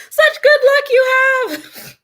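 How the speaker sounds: very high, loud gushing neighbor; hammed-up busybody, squealing with envy; screeching neighbor. Neighbor voiced, laughing.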